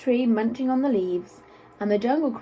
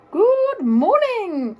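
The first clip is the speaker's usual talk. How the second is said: high, drawn-out trumpeting greeting; trumpeting elephant; 10 semitones higher, 6 dB louder, much slower than usual. A narrator's reading. Elephant greeting the jungle at dawn, trumpeting. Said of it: "The elephants would trumpet".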